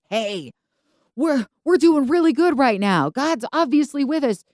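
high, boisterous, overconfident cheer; gregarious. Acted. Saul joining the battle his son began, boisterously gregarious. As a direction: crow it with hammed-up swagger. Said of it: "he gets all gregarious".

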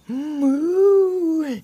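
loud, high, rising moo; long swooping cow moo. A narrator's reading. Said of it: "Taylor mooed and said".

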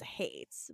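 "hates" dropped very low, air quotes; air-quoted hates. Shown as a demonstration. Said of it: "in big air quotes".

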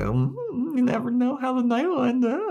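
very high, fast teasing singsong; mock coy Buffy. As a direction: tease him coyly, pitch way up.

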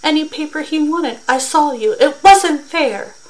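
indignant shout. A narrator's reading. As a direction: cry out that it's unfair. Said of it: "shouted to Mister Summers".

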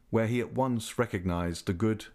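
ordinary delivery, nothing marked

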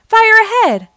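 high, loud warning cry; urgent call. A narrator's reading. Fire truck spots a fire ahead, loud high call. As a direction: sing out the alarm.

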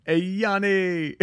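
slow, high playful call. Pretend. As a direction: call out his name. Calling out to the ghost.

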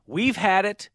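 upbeat sponsor read, deliberately emphatic. Acted. Host pitching the sponsor's new drink, announcer style. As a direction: sell it like a radio announcer.

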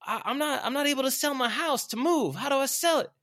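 very high, loud mock plea; complaining follower. Struggling worker asking Art for advice, high mock plea. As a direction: imitate someone bringing a problem to the boss. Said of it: "people coming up to me saying".

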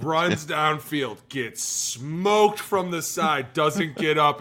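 slow, loud, high play-by-play; excited retelling. Retold or imitated. Telling the podcast host about the old kickoff film, slow and loud. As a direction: build the hit like a highlight reel.

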